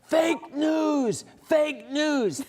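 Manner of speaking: high-pitched, drawn-out imitation of a heckler; mock heckler yell. Joker heckling a TV interview from the tent opening, mock yell. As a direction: call it out high like a passing joker. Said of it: "sticks his head into the tent and yells".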